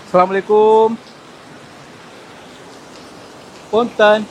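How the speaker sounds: loud greeting into the dark cave; calling out loudly. Real, not acted. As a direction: call out to whoever might be there.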